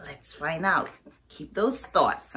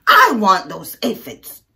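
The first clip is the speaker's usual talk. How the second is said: grouchy ladybug; 10 dB louder than usual. Acted. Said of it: "grouchy ladybug".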